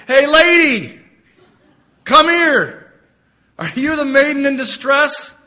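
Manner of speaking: high, loud, cocky call to the window. Acted. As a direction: holler up at her like a brash little bug. Caterpillar calling from the ledge.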